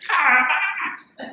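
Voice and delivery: put-on high, loud, breaking old-person voice; drawn-out quavering mock-old cry; cracked elderly voice. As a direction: imitate a little old woman whose voice cracks with rage. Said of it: "la vocecita quebrada, como los viejos".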